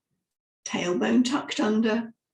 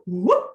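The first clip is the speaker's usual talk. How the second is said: loud rising whoop; short, loud upward whoop from the diaphragm; 2 semitones lower, 6 dB louder than usual. Shown as a demonstration. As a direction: flip the sound sharply upward. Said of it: "you'll feel your diaphragm flip up".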